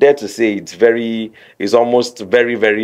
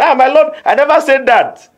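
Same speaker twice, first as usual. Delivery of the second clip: much higher, a touch louder protest; high mock denial; 14 semitones higher, 4 dB louder than usual. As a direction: squeal out an innocent denial. Lawyer denies in mock courtroom.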